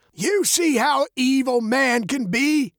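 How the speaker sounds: put-on high-pitched, ominous old man; dark old man. A narrator's reading. Old man warns young Veronica about evil, darkly. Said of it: "the old man said darkly".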